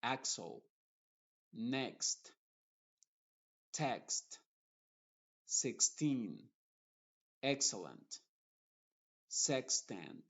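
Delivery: next, text and expert with a clear ks; x before a consonant said as ks; English x before consonant. Shown as a demonstration. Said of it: "Por ejemplo".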